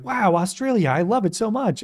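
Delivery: high-pitched mock gushing; hammed-up wide-eyed fan reaction; gushing reactor. Armchair reactor pretending to love Australia, gushing. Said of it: "those reactors that are just gonna sit in their room".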